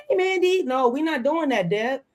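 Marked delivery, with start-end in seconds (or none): 0.1-0.6 imitating a sugary high hello; fake-sweet hello to a friend; lightly mocking the wife greeting Mandy; mock friendly greeting. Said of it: "she was like".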